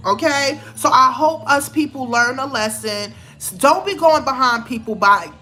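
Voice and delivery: usual speaking voice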